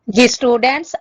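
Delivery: loud teacher's call to attention; calling the class. Real, not acted. Teacher turning to today's lesson, loud call to students.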